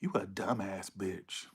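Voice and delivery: low, slow, hushed put-down; quiet insult. Real, not acted. Reader to the Gemini querent, quiet insult.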